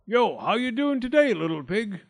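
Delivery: high, loud wolf greeting easing off; hearty, sly wolf hello; grinning wolf. A narrator's reading. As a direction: hail your prey with a big grin. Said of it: "grinned the wolf".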